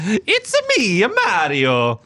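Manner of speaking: Mario-style Italian accent; chirpy Italian Mario voice. Shown as a demonstration. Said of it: "doing an Italian accent".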